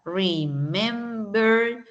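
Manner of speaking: remember drawn out as re-mem-ber; re, mem, ber, stretched apart; three separate syllables. Shown as a demonstration. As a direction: slowly sound out each syllable of remember. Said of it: "por tres sonidos".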